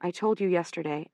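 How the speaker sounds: usual speaking voice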